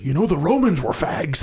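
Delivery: lightly put-on politician impression; Richard Nixon. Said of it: "In his fucking voice".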